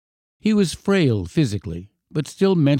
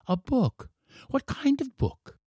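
put-on high, soft child's questions; surprised boy; 14 semitones higher, 6 dB quieter than usual. A narrator's reading. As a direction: ask as a baffled little boy.

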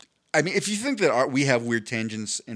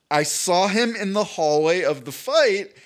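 ordinary talk, nothing marked

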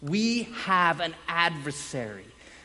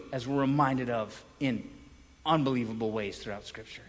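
normal talking voice

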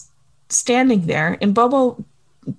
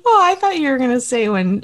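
usual speaking voice

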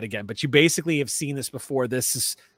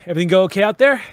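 raised, louder voice for a gate guard's question; Piper; 5 semitones higher, 9 dB louder, much faster than usual. Acted. Gate guard checking on a returning traveller, calling out. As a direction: call it out loudly, pitch lifting. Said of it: "she goes".

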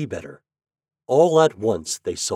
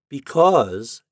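mildly plaintive little pumpkin, raised voice; sad answer; 5 semitones higher, 5 dB louder than usual. A narrator's reading. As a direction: answer with a touch of woe. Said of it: "answered the little pumpkin sadly".

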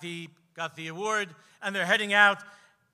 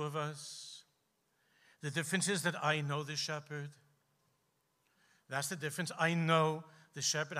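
softer, lower, unhurried rabbi's reply; gentle old rabbi; 4 semitones lower, 6 dB quieter than usual. Acted. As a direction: speak like a kind old rabbi sharing a secret. And the old Rav said.

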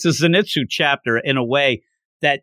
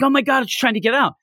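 mock panic; 8 semitones higher, faster than usual. Retelling a panicked character.

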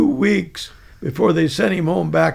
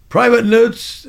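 louder, higher roll call; sergeant calling name; 5 semitones higher, 5 dB louder, slower than usual. Retold or imitated.

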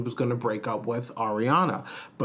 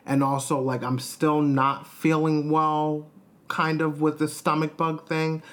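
usual speaking voice

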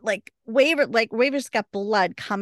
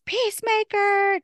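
yelled name; 13 semitones higher than usual. Retold or imitated. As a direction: shout Peacemaker!. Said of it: "Wynonna just yells".

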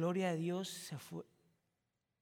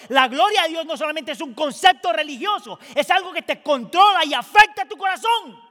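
full-force preaching; 7 semitones higher, 16 dB louder than usual. Acted. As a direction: preach it hard to the church.

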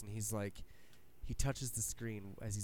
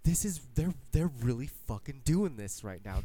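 louder, higher, hyped disbelief; excited disbelief; 3 semitones higher, 6 dB louder than usual. Retold or imitated. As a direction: blurt it out like a thrilled fan. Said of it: "And you're like".

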